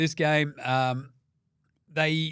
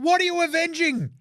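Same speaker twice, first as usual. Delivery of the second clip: much higher and louder, exasperated; incredulous rant; 5 dB louder than usual. Real, not acted. Host mocking Team USA's Avengers name, loud disbelief. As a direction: blast the question again in disbelief.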